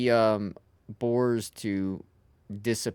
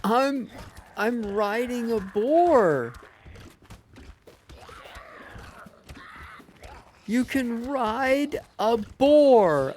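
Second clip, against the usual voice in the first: excited; 10 semitones higher, slower than usual. Real, not acted. Slow, high, announcing his discovery.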